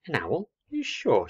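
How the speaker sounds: high-pitched disbelieving questions; incredulous little girl. Acted. Little girl doubting the woolly ball is an owl, incredulous. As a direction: rise sharply in childlike doubt.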